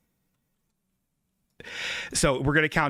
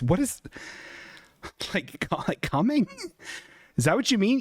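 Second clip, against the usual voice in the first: usual speaking voice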